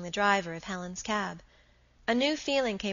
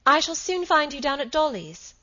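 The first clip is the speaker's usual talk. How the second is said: raised, high call across a distance; 7 semitones higher, 6 dB louder than usual. A narrator's reading. Margaret calls after her departing husband, voice raised. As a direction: project it after someone leaving. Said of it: "she called".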